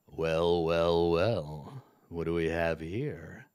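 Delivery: low, resonant villain baritone; a hint of a deep goblin king. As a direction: roll it out low like a smug lord. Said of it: "in a resonant baritone voice".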